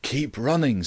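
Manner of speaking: urgent command. A narrator's reading. Grant to his friend mid-chase, urgent command. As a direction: urge a companion onward.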